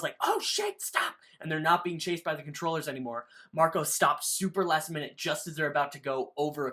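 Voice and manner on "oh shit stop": high-pitched mock yell; imitated yell. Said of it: "Tobias yells".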